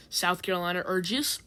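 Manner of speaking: plain reading voice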